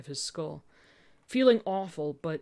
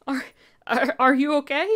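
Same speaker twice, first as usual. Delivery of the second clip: stammering, high and loud with a cracking voice; shaky stammer, voice cracking high; 8 semitones higher, 9 dB louder than usual. Acted. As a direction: fight back tears as you ask. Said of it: "even as your voice cracked".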